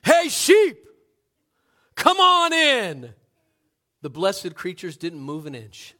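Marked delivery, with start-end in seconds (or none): 0.0-3.1 huge, high, drawn-out holler; full-force yell. Acted. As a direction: yell it like a revival preacher calling sheep. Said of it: "into a yell that revival preachers around the world would envy".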